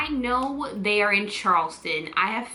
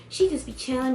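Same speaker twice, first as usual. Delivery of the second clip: ordinary talk, nothing marked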